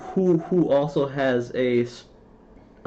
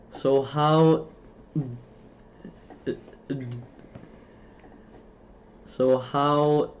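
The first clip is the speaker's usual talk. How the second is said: blocking after a drawn-out opening; retry the words and get stuck each time; stuttering; much slower than usual. Real, not acted. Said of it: "can't even speak right now".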